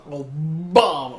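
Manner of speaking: Obama stretched very slowly; imitating Obama. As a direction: drag out Obama mockingly. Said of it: "I like saying it like that".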